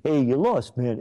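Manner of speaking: ordinary talk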